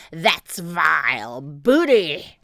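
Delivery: slow, loud villain's growl; drawn-out, disgusted pirate roar; pirate captain. A narrator's reading. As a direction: spit it out slowly in disgust.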